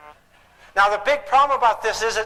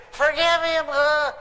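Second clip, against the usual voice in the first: high, strained mock outrage; acted incredulous echo ending in a groan; outraged disbelief; 7 semitones higher, slower than usual. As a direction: balk at it in hammed-up disgust. Acted outrage of bitter woman.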